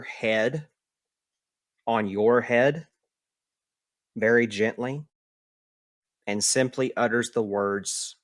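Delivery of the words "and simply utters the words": ordinary talk, nothing marked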